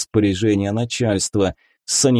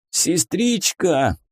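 drawn-out plea from a wounded soldier; stretched-out, hoarse appeal; 7 semitones higher, much slower than usual. A narrator's reading. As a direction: call weakly to the nurse, slowly. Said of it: "чей-то хриплый голос".

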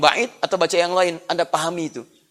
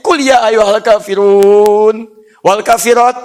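slow, loud mock-Quranic chant; mock recitation; 3 semitones higher, 8 dB louder, much slower than usual. Shown as a demonstration. Preacher chanting a deliberately wrong verse, slow and loud.

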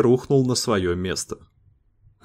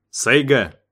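sharp call; 3 semitones higher, 3 dB louder than usual. A narrator's reading. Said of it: "прикрикнул Дэйв".

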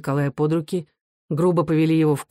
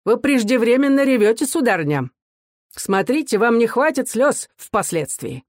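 higher-pitched, squeamish disdain; scornful officer, pitched up; disgusted remark; 6 semitones higher than usual. A narrator's reading. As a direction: talk down to her with distaste. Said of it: "брезгливо сморщив лицо, заметил".

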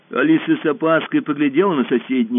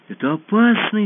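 frightened; 8 semitones higher, 4 dB louder than usual. A narrator's reading. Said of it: "испугалась Алиса".

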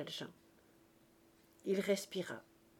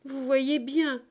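raised, louder tearful insistence; 6 semitones higher, 9 dB louder, slower than usual. A narrator's reading. Cosette in tears reassuring her father, pleading loudly. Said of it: "reprit Cosette tout en larmes".

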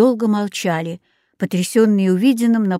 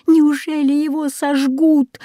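put-on higher-pitched, despairing girl's voice; desperate cry; 6 semitones higher than usual. A narrator's reading. Katya sees Bruno facing the fire, desperate cry. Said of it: "отчаянно закричала Катя".

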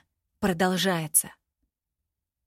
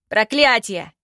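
loud furious curse, falling; helpless rage; 2 semitones higher, 7 dB louder than usual. Acted. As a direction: repeat the curse in helpless fury. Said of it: "в бессильной ярости".

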